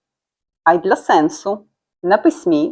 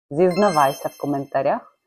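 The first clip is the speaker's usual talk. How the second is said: normal talking voice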